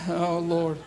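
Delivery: ordinary talk, nothing marked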